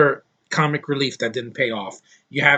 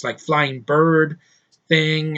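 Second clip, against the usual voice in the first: normal talking voice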